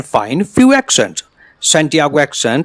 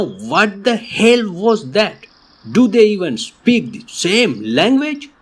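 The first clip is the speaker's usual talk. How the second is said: slow, puzzled disbelief; a lightly voiced confused listener; baffled viewer; 6 semitones higher than usual. Teacher voicing a confused learner's baffled reaction, slowly. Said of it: "You might be thinking now".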